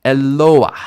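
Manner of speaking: "Eloah" with a loud, drawn-out LO; e-LO-ah, falling after the stressed LO. Shown as a demonstration. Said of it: "That's not the same word".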